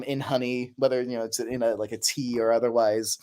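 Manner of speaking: ordinary talk, nothing marked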